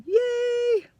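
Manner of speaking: high, long drawn-out yay; celebratory cheer. Real, not acted. Teacher celebrating a fixed dance number, high drawn-out cheer. Self-mocking cheer at end.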